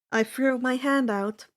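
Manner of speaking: usual speaking voice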